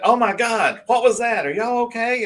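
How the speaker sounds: panicked bystanders rushing over; alarmed. Retold or imitated. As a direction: call out in alarm. Others run up alarmed.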